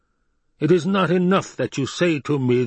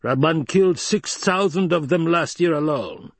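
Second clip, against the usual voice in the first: high, emphatic protest; insistent protest; 9 semitones higher, 3 dB louder than usual. Acted. Baron argues against Hawat's silence, insistent. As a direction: press the figures on a doubter.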